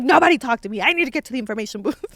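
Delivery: loud, fast, fed-up blurt; retelling her angry rush to the booth; mock angry. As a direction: storm in and blast it out fast. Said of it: "I was all mad".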